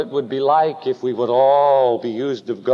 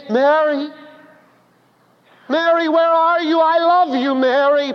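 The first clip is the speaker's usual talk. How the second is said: pleading husband; 11 semitones higher than usual. Acted. Husband calls for hiding wife.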